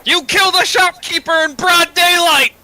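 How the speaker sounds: high, loud accusing yell. Acted. Party member yelling at the killer in the street. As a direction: shout it at your partner in disbelief. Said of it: "can we stop yelling about this out loud".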